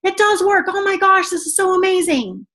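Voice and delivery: high, gushing delight; retelling her own giddy excitement, pitched high; excited gush. Retold or imitated. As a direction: rave like you can't believe it works. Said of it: "I was like".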